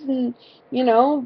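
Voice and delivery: ordinary talk, nothing marked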